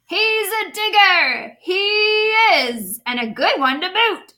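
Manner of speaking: hearty pirate announcement; pirate yelling to crew. Acted. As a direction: call it back to the crew with glee. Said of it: "then yelled back to his crew".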